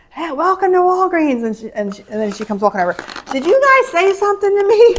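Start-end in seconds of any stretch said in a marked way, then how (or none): 0.1-1.4 high, loud shop greeting call; yelling greeting. Retold or imitated. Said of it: "was yelling when you come in". 3.3-5.0 put-on high, loud old lady asking who spoke; a hard-of-hearing little old lady calling out high.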